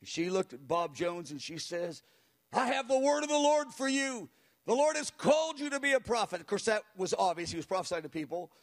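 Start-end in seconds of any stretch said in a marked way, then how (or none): 2.5-6.4 much higher, fiery prophetic declaration; zealous prayer. Retold or imitated. Tiny elderly woman prophesying into the microphone with zeal. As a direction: proclaim it with fire. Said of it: "she had a voice".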